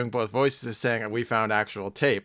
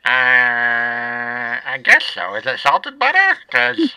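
Little Squeezer voice; 4 dB louder than usual. Acted. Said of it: "I should just do both voices".

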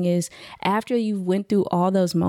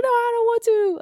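put-on high, whiny child voice; imitation of a reluctant kid; refusing son. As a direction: mimic a little boy balking. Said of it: "He's like".

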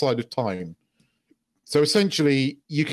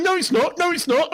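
high whiny mock protest; mocking whiny denial; 13 semitones higher than usual. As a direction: whine it like a sulky kid.

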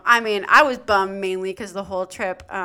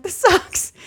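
grumpy complaint; 11 semitones higher, 7 dB louder than usual. Retold or imitated. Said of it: "like, grumpy and cramping".

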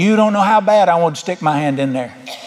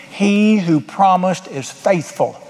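slow emphasis; much slower than usual. Acted. Preacher slows for scripture promise.

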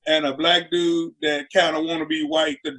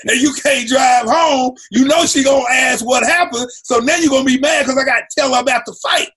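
loud, higher scolding rant; scolding girlfriend; 6 semitones higher, 3 dB louder than usual. Retold or imitated. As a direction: tell him off for losing the keys. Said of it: "She like".

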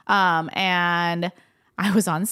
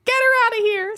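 high, loud imitation of rejection; mock outraged dismissal; 5 dB louder than usual. Imagined congregation casting her out, mock outrage. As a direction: throw her out in a raised, put-on voice.

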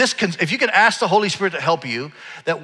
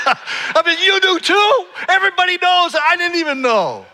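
very high, mock-betrayed squawk; incredulous outcry; 4 dB louder than usual. Son finding out his mom knew too, comically incredulous. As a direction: cry out in comic disbelief, pitched right up. Acted shock at mom knowing.

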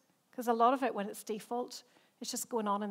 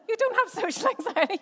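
laughing; 12 semitones higher, 9 dB louder than usual. Real, not acted. Laughing while repeating her words.

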